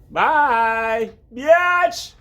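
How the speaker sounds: high, loud yell shooing the cat; squealing goodbye to a pet; shouting the cat out. Real, not acted. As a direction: send the cat off with a shrill shout.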